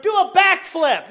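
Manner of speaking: high, loud slowed mock shout; mock permissive parent. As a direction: shout the silly dare as a joke. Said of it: "We don't sit there and s".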